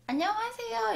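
high, soft-toned model hello; demonstrated pet greeting. Shown as a demonstration. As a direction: show the cat how to say hello. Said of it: "이렇게".

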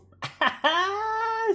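a sudden, high, loud laugh; high burst of laughter. Real, not acted. Laughs at near-loss in the game.

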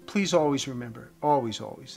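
usual speaking voice

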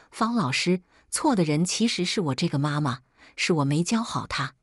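ordinary delivery, nothing marked

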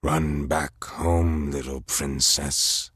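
slow, low, mocking giant; frost giant voice. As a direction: sneer it like a huge icy brute. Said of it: "one of the frost giants spoke".

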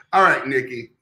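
usual speaking voice

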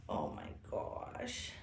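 soft slow whisper; hushed tender whisper. Real, not acted. Whispering to doll.

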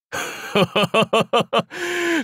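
high, rolling gleeful laugh; staccato warlord cackle. Acted. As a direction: laugh long with delight at the spoils. Delighted at looting festival.

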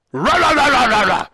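loud, high dog barking; frenzied imitated barking. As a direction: bark wildly in a rapid burst. Attempting a good bark.

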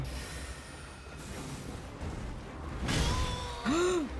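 high shocked gasp; sharp gasp. Real, not acted. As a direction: gasp in alarm. Gasping at a close hit.

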